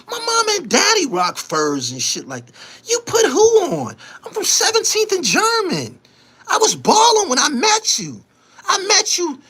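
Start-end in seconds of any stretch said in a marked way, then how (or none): 2.9-9.4 indignant shout. Real, not acted. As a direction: blast him, voice cracking with indignation. Heated rant at rival, shouting.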